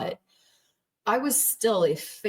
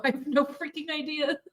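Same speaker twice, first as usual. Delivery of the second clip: exasperated quip; 9 semitones higher than usual. Retold or imitated. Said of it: "And I go".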